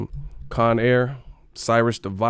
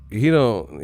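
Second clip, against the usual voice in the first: normal talking voice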